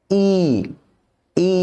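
long drawn-out "ই"; long vowel. Shown as a demonstration. Teacher demonstrating long vowels to learners, stretched "ই". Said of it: "একটু টান দিয়ে উচ্চারণ করতে হবে".

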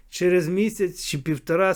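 usual speaking voice

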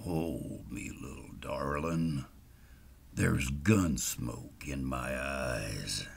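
put-on low, slow drunken slur; slurred drunk voice. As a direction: drawl it out like a drunk old cowboy. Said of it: "Gene said in a very slurred voice".

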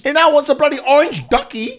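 normal talking voice

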